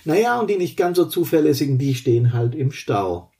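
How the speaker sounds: ordinary delivery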